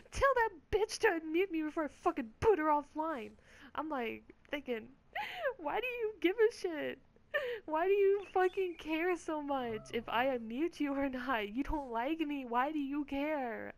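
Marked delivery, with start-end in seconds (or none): 0.1-3.3 high, loud imitation of an irate teammate; mock angry rant. Teammate ranting about her behind her back, mock angry. 5.6-13.7 high-pitched, incredulous questioning, dragging the later words; exasperated retort. Retold or imitated. She retorts in her head to the teammate, exasperated.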